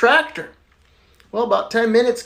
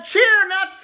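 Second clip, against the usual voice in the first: called out high and sharp like a trainer; mock trainer's command; barked command; 10 semitones higher than usual. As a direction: bark the cue at the crowd. Doctor commanding patients.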